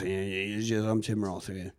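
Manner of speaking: low, very quiet, can't-be-bothered actor; Tim Roth. Acted. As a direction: toss it off low and soft, barely trying. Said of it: "Tim Roth can either be".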